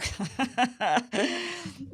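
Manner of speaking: high derisive laugh; scoffing laugh. Real, not acted. Host scoffing at the hypocrisy with a high laugh. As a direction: laugh it off in scorn.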